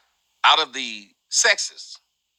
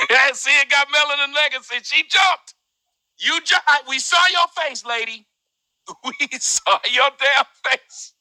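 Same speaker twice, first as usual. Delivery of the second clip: laughing; 10 semitones higher than usual. Real, not acted. Laughing at woman's startled face.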